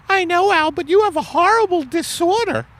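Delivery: very high falsetto wife voice; a high put-on wife, puzzled; Margaret voice. Acted couple skit, wife voice.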